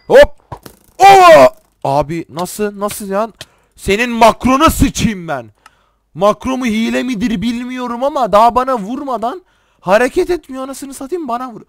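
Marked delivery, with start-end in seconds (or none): 0.1-1.5 high, loud startled whoop; excited yelp. Real, not acted. Player reacting to sudden game action, excited yelp. 3.8-11.6 high, raised-voice complaint; indignant cursing. Real, not acted. Gamer accusing an opponent of using a macro, indignant rant.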